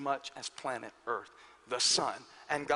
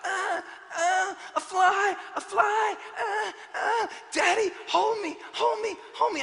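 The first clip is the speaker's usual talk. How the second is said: put-on high, crying little-boy panic, half-shouted; panicked crying child; 12 semitones higher, 3 dB louder than usual. Pastor imitating his scared son begging daddy, high panicky cries. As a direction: imitate a small boy shrieking at a fly. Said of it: "he starts flipping out".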